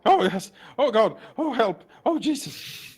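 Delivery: loud, high, rising panic; panicked yelping. Acted. Released bandit told to get out, yelping in panic.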